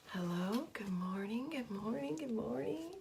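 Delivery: breathy whispered good morning; whispered greeting. Real, not acted. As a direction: softly whisper hello to joiners. Soft greeting to arriving viewers.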